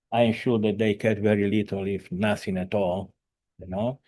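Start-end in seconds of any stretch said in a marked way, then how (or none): none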